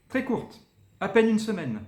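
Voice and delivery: quick high "très courte"; said fast. Shown as a demonstration. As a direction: rush "très courte, à peine une semaine". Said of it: "je le dis très vite".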